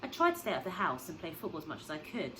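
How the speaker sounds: usual speaking voice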